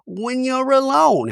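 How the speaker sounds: high, put-on voice, slowing at the end; mock earnest confessional; hammed-up talking-head Gina; Gina impression. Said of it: "Gina in a talking head is like".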